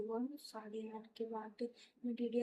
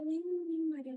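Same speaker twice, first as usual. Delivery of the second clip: higher, louder hurried no no; insistent denial; 6 semitones higher, 7 dB louder than usual. Retold or imitated. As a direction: brush the question off fast. Son denies anyone hit her. (ऊँची, ज़ोरदार जल्दी इनकार)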